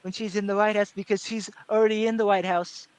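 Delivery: stump-speech Trump, halting phrases; Trump impression. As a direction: do Trump addressing a rally, pausing between chunks. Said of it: "that's kinda how he talks".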